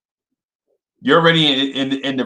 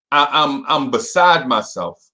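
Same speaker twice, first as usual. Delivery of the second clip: ordinary talk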